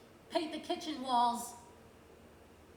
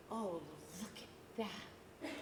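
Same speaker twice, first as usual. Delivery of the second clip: slow, soft, wondering gasp of delight; a slow, hushed sigh of awe; 6 semitones lower, 12 dB quieter, much slower than usual. Acted. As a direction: breathe it out in quiet wonder. Awed first look at mural.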